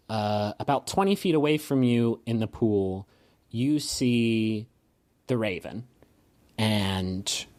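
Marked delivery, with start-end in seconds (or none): none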